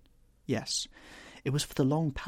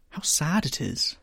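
slow, higher lament; wistful, slow and raised in pitch; sad murmur; 6 semitones higher, 4 dB louder than usual. A narrator's reading. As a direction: draw it out with sorrow. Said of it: "murmured Dorian Gray".

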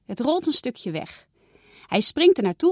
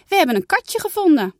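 higher, louder excited news; excited calling; 10 semitones higher, 7 dB louder than usual. Acted. Janna calling to mama about the kitten, louder excited call. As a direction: burst in with the big news. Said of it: "roept Janna".

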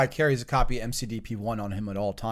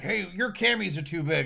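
higher, softer quote of a nitpicker; higher, softer mock complaint; lightly imitated critic; 4 semitones higher, 6 dB quieter than usual. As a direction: imitate a nitpicker, pitched up and quiet. Critic in a retold exchange.

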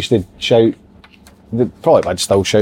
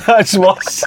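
normal talking voice

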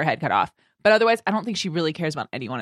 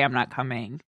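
low, soft and heavy; quiet sad line; 7 semitones lower, 7 dB quieter than usual. Retold or imitated. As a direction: say it like a goodbye you hate. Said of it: "shows up to say".

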